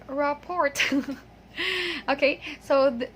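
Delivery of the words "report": "report" with the final T sounded; mispronounced rapport. Retold or imitated. Teacher quoting corporate people, T mispronunciation. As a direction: end "report" on a clear T. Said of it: "So they always say".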